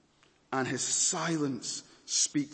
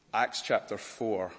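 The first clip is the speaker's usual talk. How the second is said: ordinary delivery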